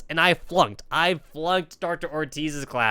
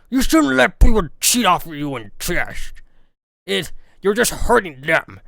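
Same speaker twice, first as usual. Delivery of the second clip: louder hoarse teacher warning; imitating Doctor Humphrey; 5 dB louder than usual. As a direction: croak out a gentle scolding. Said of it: "Doctor Humphrey".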